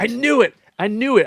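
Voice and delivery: high and loud, triumphant; gleeful told-you-so outburst; excited. Real, not acted. Catches chatter he predicted.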